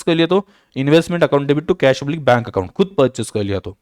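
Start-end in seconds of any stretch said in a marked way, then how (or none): none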